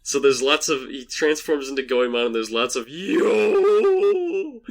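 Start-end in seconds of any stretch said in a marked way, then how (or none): 2.9-4.6 slow, high drawn-out yo shout; bad kabuki yo impression. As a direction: bellow a hammy kabuki yo. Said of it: "It's the worst impression of that".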